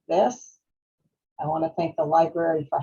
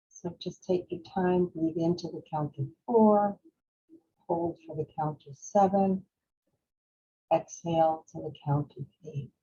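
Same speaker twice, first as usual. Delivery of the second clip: guided breathing meditation; 4 dB quieter than usual. Real, not acted. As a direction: lead them gently through each breath, unhurried. Leads a breathing exercise.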